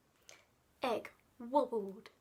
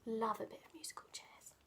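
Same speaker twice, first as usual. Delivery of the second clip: quick whispered aside; 4 semitones lower, 11 dB quieter, much faster than usual. Real, not acted. Fond aside.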